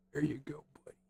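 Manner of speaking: soft whisper to the cat. Real, not acted. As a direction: whisper it as you finish the pets. Petting the cat.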